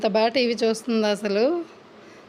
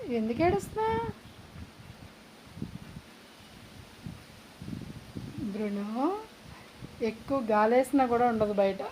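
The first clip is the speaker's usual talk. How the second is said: soft coaxing; 8 dB quieter than usual. Real, not acted. Hushed coaxing of dog.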